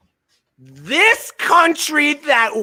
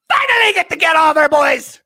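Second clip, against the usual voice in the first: excited; 6 semitones higher, 5 dB louder than usual. Real, not acted. Excited about the Olympic roster.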